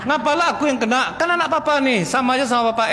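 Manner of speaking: high, laughing protest; mock protesting husband. Husband protesting to his wife, high and half-laughing. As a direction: complain half-laughing at the blame.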